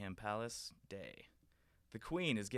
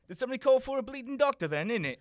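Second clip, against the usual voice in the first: higher, louder and fast cockney swagger; cockney Doctor; 12 semitones higher, 9 dB louder, faster than usual. Acted. The Doctor arriving at the palace, in loud cockney. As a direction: burst in as a brash cockney hero. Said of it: "character actor ... comes walking out".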